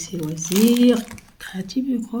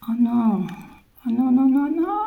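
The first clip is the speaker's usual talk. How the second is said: alarmed; 6 semitones higher than usual. Real, not acted. Beads about to spill.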